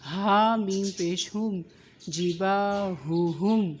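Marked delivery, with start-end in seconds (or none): 2.1-3.7 slow جی با ہو ہم with heavy ha; weight both ha's in جی با ہو ہم; heavy ha sounds. Shown as a demonstration. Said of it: "ہا کی آواز بھاری ہوگی". (جی با ہو ہم، ہا کی آواز بھاری)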